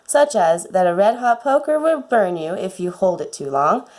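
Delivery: usual speaking voice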